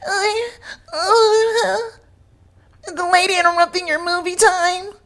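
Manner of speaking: high, whiny mock voice with fake sobs; mocking whiny man. Mocking the passenger upset over his movie, high whiny voice. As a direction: whimper it like a spoiled crybaby.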